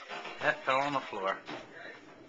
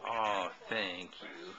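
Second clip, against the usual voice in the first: tender thanks; 8 semitones lower, 4 dB quieter, much slower than usual. Real, not acted. Toddler feeds dad a bite.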